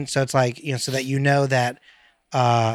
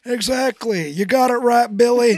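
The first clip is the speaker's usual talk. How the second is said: put-on higher old grandpa voice; old man voice; 9 semitones higher than usual. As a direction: praise him like a doting grandpa. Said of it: "Everybody's Billy when you talk to Grandpa".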